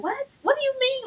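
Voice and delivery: high squeaky what; a high incredulous squeak at the news; mock disbelief. Man hearing the warning, high incredulous squeak.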